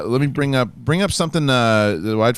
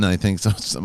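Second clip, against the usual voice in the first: ordinary talk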